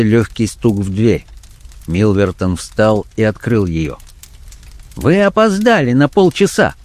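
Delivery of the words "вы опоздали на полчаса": high-pitched, sharp character voice; sharp rebuke. A narrator's reading. Blackmailer scolding a late night visitor, sharply. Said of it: "произнёс он резко".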